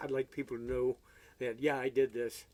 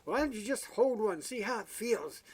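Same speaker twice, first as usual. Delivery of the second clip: much higher, wheedling salesman voice; eager salesman; 8 semitones higher than usual. Retold or imitated. Salesman urging customer to hold a guitar, high wheedling voice. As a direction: wheedle him into trying it, pitched high. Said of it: "he said".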